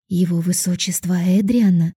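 ordinary delivery

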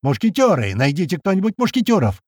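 high, carrying rallying calls; energetic hollered summons; yelling. A narrator's reading. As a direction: shout round for the squad. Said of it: "это уже Крот орёт".

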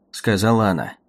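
ordinary talk, nothing marked

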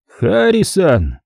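high, drawn-out, gloating call of the name; menacing caller. A narrator's reading. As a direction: stretch the name out high, like a threat. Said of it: "В голосе звучала злость". (злобно, высоким протяжным голосом)

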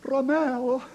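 soft, gentle, dreamy call; tender and languid. Shown as a demonstration. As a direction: breathe the name lovingly. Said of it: "так говорите нежно, томно".

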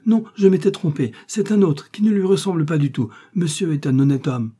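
high, loud, urgent retraction; frantic exclamation. A narrator's reading. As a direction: plead loudly, backtracking in a panic. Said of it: "s'écria Bonacieux".

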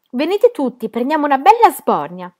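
high, loud call to all; rally the whole room for a drink; rousing invitation. A narrator's reading. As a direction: exuberant shouted invitation. Brawler invites everyone to drink.

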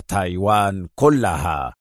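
slow, measured close on كلها; slow, firm statement. A narrator's reading. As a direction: state تايوان كلها slowly, voice falling. First take, before the bitter cry.

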